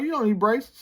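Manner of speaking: high-pitched mimicking of other people; mocking high voice. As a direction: parrot them in a silly high voice. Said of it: "People are like".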